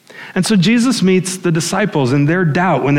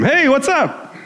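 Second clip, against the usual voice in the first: high, sing-out mock greeting; casual intruder's greeting; 12 semitones higher, slower than usual. Intruder greeting a startled family at home, breezy high call. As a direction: call out like a chummy surprise visitor. Voices surprise visitor in living room.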